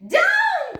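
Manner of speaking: shouted high and loud command; witch crying out. A narrator's reading. Witch shouts to her passengers. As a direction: witch yelling down from the broom. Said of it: "cried the witch".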